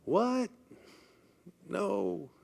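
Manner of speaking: a sharp, high startled "What?" then a flat no; mock the brain's instant objection; high startled gasp of protest; inner alarm. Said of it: "the hippocampus is very recent and saying".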